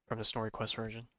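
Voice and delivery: ordinary delivery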